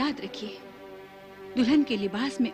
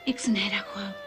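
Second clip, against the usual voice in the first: dreamy confiding; 3 semitones lower than usual. Acted. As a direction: share a secret dream in a hushed voice. Confides a secret dream to friend. (धीमे, ख्वाबीदा स्वर में राज़ बताते हुए)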